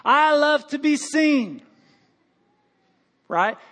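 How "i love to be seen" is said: higher, louder, slow and showy; mock vain singer. Pastor mocking a vain choir singer, showy and slow. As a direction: ham up a show-off chorister. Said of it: "She didn't say".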